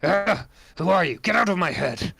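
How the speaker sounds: high, strained cry of alarm; startled werewolf. Acted. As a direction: lash out at the voice in your head. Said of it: "he sort of stumbles back".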